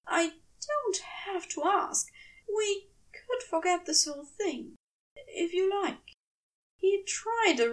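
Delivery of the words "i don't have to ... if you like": a touch higher, hesitant angel; gently backing off, unsure; lightly voiced fussy angel. Angel offering to drop the whole thing.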